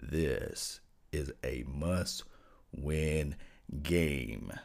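very low, very slow bass rumble; extremely deep bass voice. Shown as a demonstration. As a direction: sink into your deepest bass and drag it out. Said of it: "I'm gonna get into my deepest voice I can let you guys hear".

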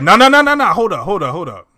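high, loud, rapid-fire mock protest; urgent objection. Peter stopping the newcomers, imitated urgent objection. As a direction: cut them off with a retold burst of refusal. Acted alarmed refusal in retold scene.

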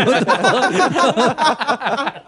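high, drawn-out laughing what the fuck; laughing disbelief. Retold or imitated. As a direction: stretch it out while cracking up. Retold own shock, laughing.

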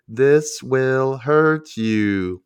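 very slow, drawn-out warning; ominous caption read. Acted. Reads out an on-screen warning.

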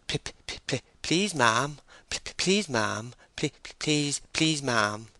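anxious, repeated stammer. Acted. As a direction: get stuck on the first sound of please. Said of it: "As a stammering man".

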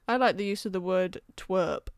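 normal talking voice

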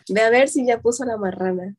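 rattled off quickly; quick casual Spanish phrase; fast. Shown as a demonstration. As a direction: say it fast like a native. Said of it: "we should say that one a little slower".

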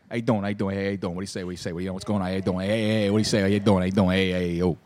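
low, rapid-fire South Philadelphia hellos; deep, quick South Philadelphia street hello; South Philly greeting. Retold or imitated. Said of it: "that's how you say hi to people down here".

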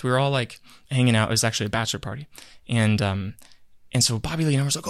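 ordinary talk

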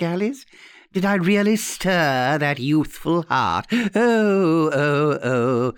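put-on theatrical old lady, sweetly taunting; horrible old aunt. Aunt taunting nephew and Alice, theatrically sentimental. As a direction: swoop and sigh with mock sentiment. Aunt's voice in the story.

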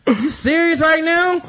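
high, loud offended protest; outraged, near shouting in disbelief; indignant outburst. Retold or imitated. Son snapping at the king's man over his dead father, high and loud. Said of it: "he was like".

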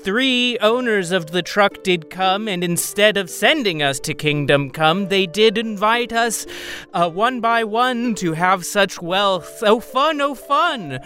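theatrical singsong poem; Melanie reciting. Acted. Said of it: "And then Melanie says".

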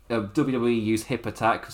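normal talking voice

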